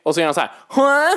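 normal talking voice

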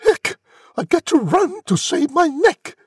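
very high, loud cry; panicked shout. A narrator's reading. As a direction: shout it high as you bolt away. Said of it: "She shouted".

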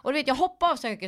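usual speaking voice

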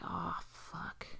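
whispered "uh-oh" dismay; soft, voiceless alarm; retold hushed dread. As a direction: act out sinking dread under the breath. Retold dread as door kicked in.

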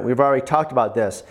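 usual speaking voice

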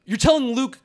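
usual speaking voice

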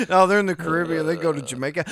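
normal talking voice

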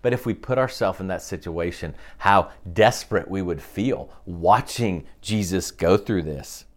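ordinary talk, nothing marked

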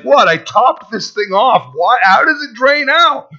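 rising, incredulous "how does it drain out"; mock outrage. Pastor voicing men baffled their love drains away. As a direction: pitch climbing in mock disbelief. Voicing men's puzzled attitude.

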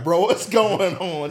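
mock alarmed call. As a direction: play it as a startled shout. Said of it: "I was like".

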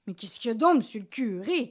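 plain reading voice

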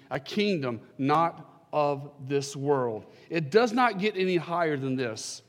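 ordinary delivery

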